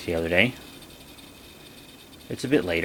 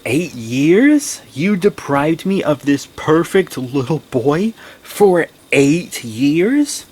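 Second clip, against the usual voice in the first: slow, louder, rising disbelief; incredulous outrage; 6 semitones higher, 6 dB louder, much slower than usual. Real, not acted. She protests to her absent dad, incredulous. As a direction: hammer home the eight years.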